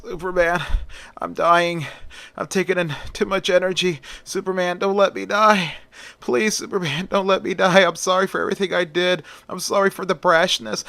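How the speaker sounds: high, frightened pleading; acted desperate apology, pitched well up; desperate begging. A narrator's reading. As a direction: beg for your life as you are dying. Dying villain begs hero to save him.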